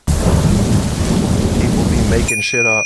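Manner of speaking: loud, drawn-out complaint; loud, slow grumble. Real, not acted. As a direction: grumble it out slowly and loudly.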